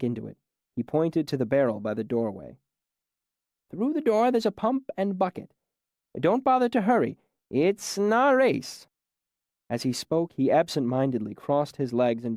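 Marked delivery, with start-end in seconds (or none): 3.7-8.9 put-on higher, patient old man voice, mildly surprised; friendly old man. A narrator's reading. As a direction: greet the stranger kindly. Old man gives directions.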